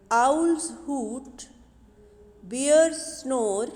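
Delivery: put-on loud, measured recital of a children's poem; poem recitation. Teacher reciting the poem to her young pupils, loud and measured. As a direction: recite each animal line clearly for the class.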